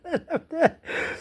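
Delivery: high, soft giggles; real laughter. Real, not acted. Cracking up over the argument with sister, laughing. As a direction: break up into light chuckles. Breaks up after sister's remark.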